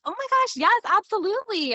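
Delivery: high-pitched, overdone gushing agreement; pretend enthusiasm. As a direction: fake being totally delighted. Acting out her pretended agreement.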